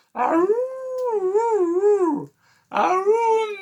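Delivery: very high, loud drawn-out howls; dog howl. Acted. Sassy dog howling at Alligator, high loud howl. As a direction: howl up into the air like a hound. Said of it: "and he howled".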